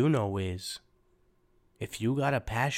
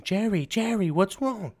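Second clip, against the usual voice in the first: mock mom's scream, higher and louder; 10 semitones higher, 4 dB louder than usual. Mother runs down to the basement, mock high scream. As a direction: play the frantic mother rushing downstairs, lightly hammed. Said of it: "She comes downstairs screaming".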